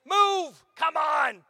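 road-rage yell at a stranger's car; yelling at traffic. Retold or imitated. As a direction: bark it at the slow car ahead. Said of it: "you're trying to yell at this person".

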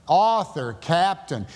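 usual speaking voice

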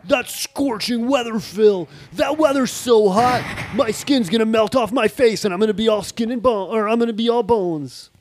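a lightly voiced cartoon puppy, ham it up. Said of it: "That was Puppy".

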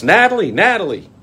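high, loud sing-song call; mock calling out. Calling the vanished scammer, loud and high. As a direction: call her name like across a room.

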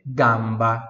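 gamba in careful Italian; Italian model word. Shown as a demonstration. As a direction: say gamba with a wide open a. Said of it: "Repita em voz alta". (pronúncia italiana de gamba)

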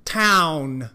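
town 里的 ow 用力念得饱满; 把 town 的 ow 音用力发出来; strong ow in town. Shown as a demonstration. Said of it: "把那个ow的音用力的发出来". (用力示范 town)

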